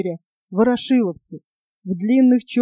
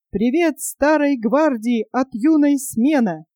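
ordinary delivery

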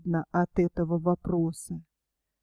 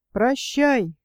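ordinary delivery

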